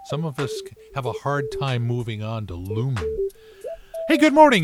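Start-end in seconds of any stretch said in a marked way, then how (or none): 4.1-4.6 high, loud radio DJ hello; big cheerful radio greeting; loud excited greeting. Real, not acted. DJ greeting after song.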